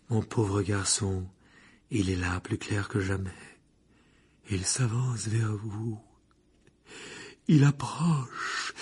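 slow, drawn-out prophecy of doom; ominous seer. A narrator's reading. Professor warning a boy of approaching death, ominous. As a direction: stretch each word with foreboding.

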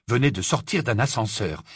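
plain reading voice

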